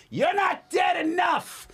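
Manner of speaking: slow, high, loud shouted taunt; yelling out the window. Retold or imitated. Uncle yelling at the cemetery from the truck, slow loud shout. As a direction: bellow it at the graves.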